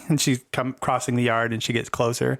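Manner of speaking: usual speaking voice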